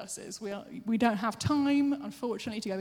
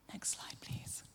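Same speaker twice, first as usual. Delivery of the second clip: whispered cue to the tech; a soft unvoiced request; 13 dB quieter, slower than usual. Real, not acted. Preacher quietly asking Elspeth for the next slide, whispered.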